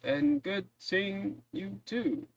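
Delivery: put-on slow, high, dry old librarian. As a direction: call after her, a touch put out. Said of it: "he goes".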